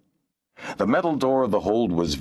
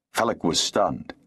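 usual speaking voice

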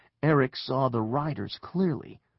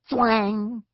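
high, loud twanging noise; arrow twang; 9 semitones higher, 5 dB louder than usual. A narrator's reading. Narrator as an arrow flies past, loud high twang. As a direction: voice a sharp "thwang".

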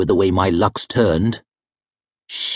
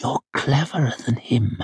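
soft urgent voice; 5 dB quieter than usual. A narrator's reading. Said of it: "soft, low, urgent voice".

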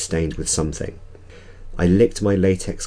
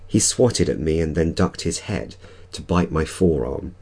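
usual speaking voice

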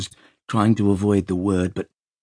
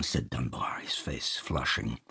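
usual speaking voice

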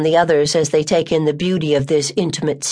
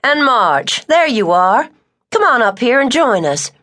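calling out warmly; 6 semitones higher than usual. Acted. Ella calling Tucker and March up, glad. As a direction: call them up to the porch.

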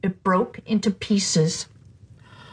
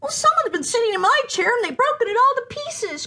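much higher, upset little bear; crying Baby Bear; 13 semitones higher than usual. Acted. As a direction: wail it like a small cub. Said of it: "cried the Baby Bear".

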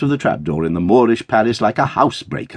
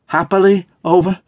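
high, louder, hopeful question; eager cry; 9 semitones higher, 5 dB louder than usual. A narrator's reading. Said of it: "cried Dot".

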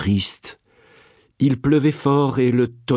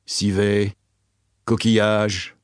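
doctor musing; 5 semitones lower, slower than usual. Acted. Doctor repeats prince's words lower.

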